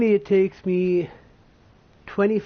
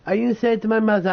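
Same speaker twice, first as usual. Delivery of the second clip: usual speaking voice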